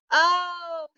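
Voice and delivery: high, surprised "oh"; gasped. A narrator's reading. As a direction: gasp with sudden realisation. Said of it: "he gasped".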